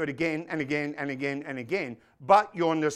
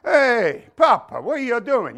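loud, raised hammed-up Italian son; Italian son from commercial; 5 semitones higher, 8 dB louder than usual. Preacher re-enacting the Prego commercial, hammed-up Italian son. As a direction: call out to Papa, mock Italian. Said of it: "this Italian guy goes home".

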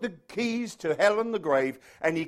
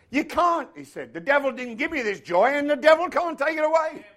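Herbie's defiant retort; 8 semitones higher, 5 dB louder than usual. Retold or imitated.

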